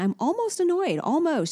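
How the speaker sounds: retold high-pitched mommy voice; mom hedging to toddler. Mom answering toddler in retold exchange.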